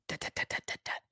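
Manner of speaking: tiny soft voiceless tongue taps; quick, quiet, dry tut-tut-tut; imitated flea ticking. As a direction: tick out fleas bouncing on rubber. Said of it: "you could hear the fleas".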